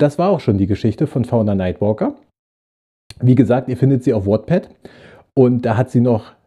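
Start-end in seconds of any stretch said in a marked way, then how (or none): none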